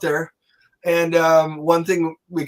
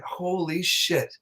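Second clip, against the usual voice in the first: awed hush; 3 semitones higher, 6 dB quieter, much slower than usual. Retold or imitated. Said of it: "I went".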